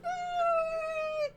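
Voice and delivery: a sudden, very high squeal like a fleeing coward; high mock scream of a coward; imitated cowardly shriek. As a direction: shriek it like a scared kid running off. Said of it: "He's like".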